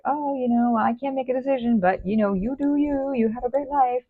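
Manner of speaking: high, breezy and dismissive; a lightly mocking "you do you" voice; cop-out brush-off. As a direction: wave them off with airy fake kindness. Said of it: "is kind of a cop-out energy".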